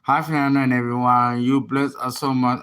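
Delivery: ordinary talk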